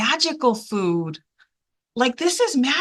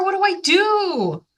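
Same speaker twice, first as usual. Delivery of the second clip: put-on higher, pleading kid's voice; child begging mom; 5 semitones higher than usual. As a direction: ask it up high like a lost little boy. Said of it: "calling his mommy to say".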